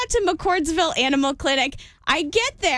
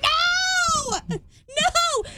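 high, crying shout of no; half-laughing retold scream; mock panicked scream; 10 semitones higher than usual. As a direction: shriek it out in disgust. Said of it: "I screamed out loud".

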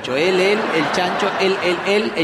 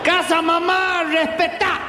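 put-on high, slow drunk broken speech; drunk brother; 9 semitones higher, slower than usual. Drunk brother demanding respect, high slow drunk slur. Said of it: "No puede conjugar los verbos del pedo".

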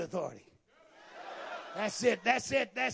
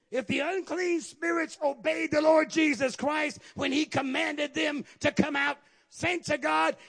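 high, driving sermon exhortation; forceful pulpit crescendo; full-force preaching; 7 semitones higher, 5 dB louder than usual. Real, not acted. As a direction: build a ringing charge to the saints.

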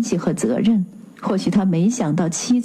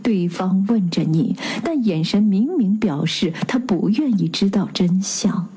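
usual speaking voice

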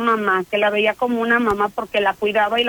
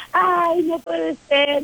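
retold wail, higher pitch, slow; mock cry of despair; 6 semitones higher, much slower than usual. The officer cries out over the girl's death, retold high and slow.

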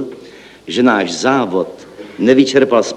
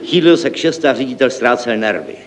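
ordinary talk

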